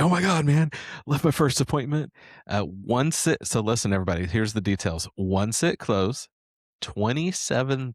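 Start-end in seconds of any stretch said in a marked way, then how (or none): 0.0-2.1 excited. Retold or imitated. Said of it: "He's like".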